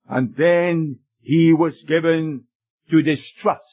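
normal talking voice

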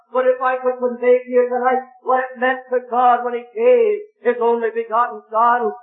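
high, impassioned preaching building to a cry at Isaac; full-force preaching. Acted. Preacher telling the meeting of God's gift, impassioned. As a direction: pour out the wonder of the sacrifice.

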